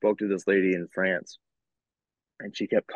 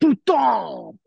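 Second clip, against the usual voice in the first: high, loud French outburst; exaggerated French swearing, pitched way up; mock French curse; 7 dB louder than usual. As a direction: yell it in a hammed-up French accent. Playing along with French hang-up joke.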